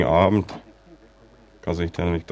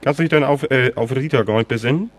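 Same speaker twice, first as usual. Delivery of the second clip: excited; 8 semitones higher than usual. Acted. Excited reminder about Rita.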